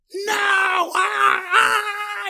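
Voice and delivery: high mock dying scream; campy horror scream; hammed-up screams. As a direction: scream being torn up. Robert ripped apart.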